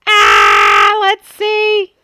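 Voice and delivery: high, loud eager exclaim; excited. Real, not acted. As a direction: burst out with eagerness. Peeking at her finished album.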